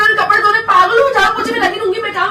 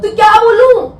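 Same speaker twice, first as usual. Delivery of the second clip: loud, higher-pitched, anguished retort; upset outburst; 4 semitones higher, 6 dB louder than usual. Real, not acted. Wife silenced by husband about family, bursts out upset. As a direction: burst out in hurt frustration. Quarrel, told not to speak.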